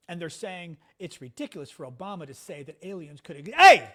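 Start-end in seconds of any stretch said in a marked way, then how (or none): 3.6-3.9 sudden high yelp of protest; startled shout. Real, not acted. Dog nips streamer's hand, startled shout. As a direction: yell out, much louder, as if bitten.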